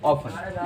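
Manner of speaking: "often" slowly, with silent t; older pronunciation. Shown as a demonstration. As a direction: repeat "often" as off-un. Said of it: "इसकी pronunciation थी often".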